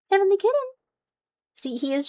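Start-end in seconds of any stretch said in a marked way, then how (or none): 0.1-0.5 cooing at a pet. Real, not acted. Calling the cat by name.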